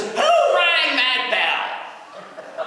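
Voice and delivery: high, slow, hammed-up doorman voice; Oz doorkeeper. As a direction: bellow it like the Oz gatekeeper with a laugh. Wizard of Oz doorman voice.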